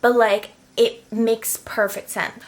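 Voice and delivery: ordinary delivery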